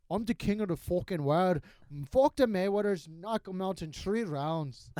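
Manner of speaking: strut and boast like McGregor; McGregor impression. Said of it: "especially Mayweather".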